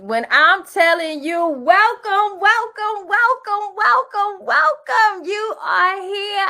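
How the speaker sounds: high, loud, drawn-out welcomes; hyped welcome. Real, not acted. Host greeting her authors with a big excited welcome. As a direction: sing out each welcome like a hype host.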